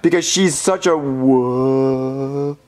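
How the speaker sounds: low, slow, stretching the last syllable; halting compliment that stalls; straining reluctance. Acted. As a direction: struggle to get the praise out. Cannot finish the compliment.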